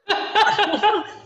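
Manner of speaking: a high burst of real laughter; laughing. Real, not acted. Laughing at how poetic the term sounds. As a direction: laugh out loud at the irony.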